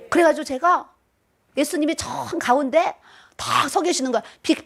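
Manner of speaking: ordinary delivery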